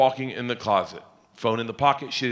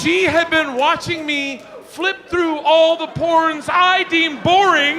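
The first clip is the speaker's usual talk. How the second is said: slow, high, drawn-out yell; exasperated climax; 13 semitones higher, 5 dB louder, slower than usual. Real, not acted. Husband revealing what his wife saw, slow mortified yell. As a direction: bellow each word slowly in mortified disbelief.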